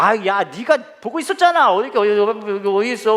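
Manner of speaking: imitating mom, high and urgent; startled mother. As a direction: scold your son in sudden alarm. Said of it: "우리 어머니가 한참 옷을 뒤지다가 이렇게 얘기하는".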